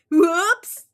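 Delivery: high, loud sheepish cry; whoops. Acted. Froggy caught on the table, high sheepish whoops.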